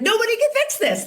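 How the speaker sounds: very high-pitched, retold exasperation; exasperated protest. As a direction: imitate yourself fending off solutions. Retold protest to problem-solving husband.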